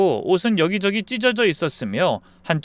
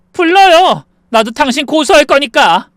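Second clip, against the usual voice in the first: very loud, high comeback; defiant shout; 11 semitones higher, 10 dB louder than usual. Acted. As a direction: yell back, daring her to do it. Daughter-in-law yells back defiantly.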